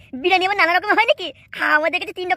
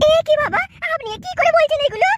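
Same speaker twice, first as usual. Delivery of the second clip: pleading daughter-in-law; 9 semitones higher than usual. Acted. Daughter-in-law protests to her father-in-law, high and upset. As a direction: plead high for the arrested husband.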